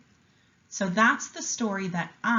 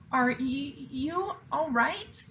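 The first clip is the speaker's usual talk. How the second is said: higher, slow, hesitant little voice; shy question; 7 semitones higher, slower than usual. A narrator's reading. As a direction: ask gently and shyly, stumbling. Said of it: "she asked shyly".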